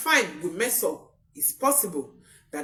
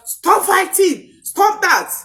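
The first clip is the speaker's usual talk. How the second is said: high, loud, slow command; stretched-out angry yell at squabbling children; parent shouting; 12 semitones higher, 8 dB louder, slower than usual. Retold or imitated. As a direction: shout to break up the kids' fight. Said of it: "chances are that you shout".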